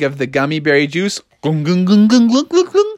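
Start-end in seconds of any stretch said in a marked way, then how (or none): 1.4-2.9 rapid, rising gulps; gulping noises. Acted. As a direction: glug it down, climbing in pitch. Imitates gulping.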